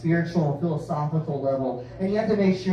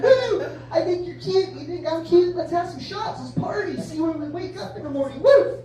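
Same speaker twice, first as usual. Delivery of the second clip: put-on drunk party whoop; 10 semitones higher than usual. Drunk guy hitting on a stranger at a bar, high and whooping. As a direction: whoop it up like a wasted partier.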